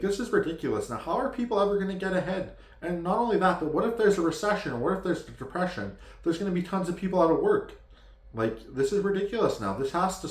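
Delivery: worked-up protest, pitch way up; indignant rant. Real, not acted. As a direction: rail against it, voice climbing high. Indignant rant about low wages.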